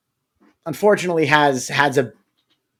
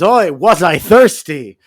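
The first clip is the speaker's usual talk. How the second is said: Oi, was I thirsty said slow and louder, satisfied; 4 semitones higher, 7 dB louder, slower than usual. Retold or imitated. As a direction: drag out Oi, was I thirsty with contented relief. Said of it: "And then he says".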